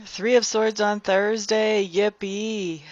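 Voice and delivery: slow, drawn-out mock cheer; totally sarcastic. Real, not acted. Reader turning up a card she dislikes, totally sarcastic. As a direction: fake delight at a disliked card. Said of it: "I'm being totally sarcastic".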